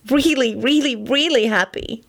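each really stressed high; emphatic. A narrator's reading. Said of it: "she emphasized sternly".

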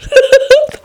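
short, high laugh. Real, not acted. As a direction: laugh at your own slip. Narrator laughs at own misreading.

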